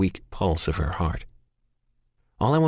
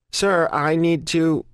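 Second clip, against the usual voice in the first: operator voice; 10 semitones higher, 3 dB louder, slower than usual. Acted. 911 operator voice.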